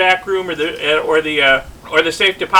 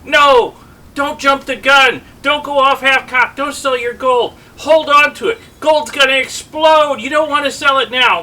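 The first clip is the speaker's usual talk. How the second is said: mock commenter warning; 6 semitones higher, 3 dB louder than usual. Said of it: "all these messages and emails and comments".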